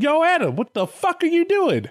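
God catching Adam in the act, shouting; God yelling. Retold or imitated. God scolds Adam with animals.

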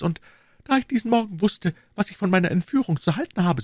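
put-on young woman's voice, pitched very high; high-voiced lady confiding in a gallant; Madame Bonacieux. A narrator's reading.

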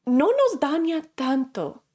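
normal talking voice

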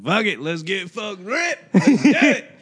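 mock country boy. Teasing his friend as a rowdy country boy. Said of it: "the country boy in you".

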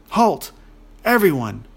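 loud, high "halt" that falls away; commanding call. A narrator's reading. Princess stopping the quarrelling dwarves and ogre, calling out. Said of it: "called Nea".

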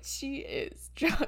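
slow, breathy laughing "She is drugstore". Retold or imitated. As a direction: giggle through "She is drugstore" slowly. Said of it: "I'm like".

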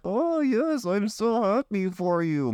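high, sugary mock congratulations; fake happy friend. Jealous friend faking joy for a happy couple, high sugary voice. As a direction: gush falsely like a jealous friend. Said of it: "they pretend like".

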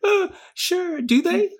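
high, mock-innocent questioning; sarcastic. Real, not acted. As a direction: tease her with high doubt. Mocking wife's guess.

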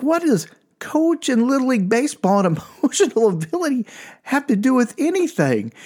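high, exasperated question; incredulous. Retold or imitated. Grown son baffled by mom's baseball argument, high and exasperated. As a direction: throw it back in disbelief, pitched high. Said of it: "I was like".

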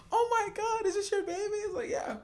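high, soft gushing voice; high excited voice. Retold or imitated. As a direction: gush over the baby photo. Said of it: "and they're like".

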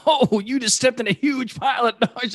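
high-pitched hammed-up outburst; mock exclamation. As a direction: call it out like an astonished bystander. Acting a bystander's exclamation.

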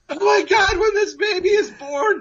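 high-pitched wailing, played for laughs; mock crying. Fired young father-to-be driving home, hammed-up crying. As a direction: imitate a panicked, tearful wail. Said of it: "crying, literally in tears".

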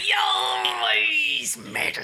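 loud, slow, high pirate shout. Pretend. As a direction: bellow it like a hammy pirate. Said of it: "in a pirate voice".

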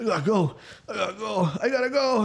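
high, rushed, panicked repeats; frantic snail. Acted. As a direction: bolt for the door in a squeaky panic. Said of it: "He's like".

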